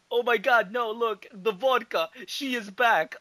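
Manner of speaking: high, loud mock panic; hammed-up alarm at the vodka; mock Boris. Boris spotting the vodka, high and in mock panic. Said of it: "Boris say".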